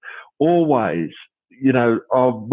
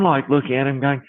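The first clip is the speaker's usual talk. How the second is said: ordinary delivery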